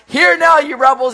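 swelling, commanding shout, pitched high; Moses, authoritative voice. Acted. Moses welling up before the people, authoritative shout. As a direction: bellow it as a harsh order. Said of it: "welling up with this kind of authoritative voice".

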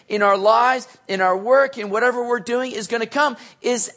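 full-throated sermon peak; preaching at full force. Acted. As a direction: drive the point home to the congregation. Pastor exhorts the congregation.

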